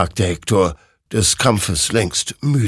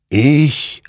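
raised, emphatic single word; murmuring rider; 9 semitones higher, 6 dB louder than usual. A narrator's reading. Rider names himself the victor, emphatic. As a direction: give the one word weight. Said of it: "murmelte dieser".

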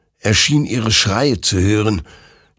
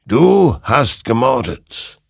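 slow, loud, raised indictment; accusing; 6 semitones higher, 5 dB louder, slower than usual. A narrator's reading. Creature charging Hector with murder, accusing. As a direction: stretch the first word hard, then condemn.

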